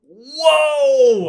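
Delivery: shocked to hear he took the bullet; shocked whoa. Real, not acted. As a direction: cry out in real amazement. Dramatic reaction to the twist.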